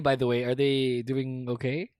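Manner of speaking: ordinary delivery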